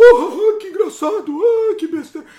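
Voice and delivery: loud, high hammed-up laughing; mock someone cackling at a video. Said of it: "ficar falando".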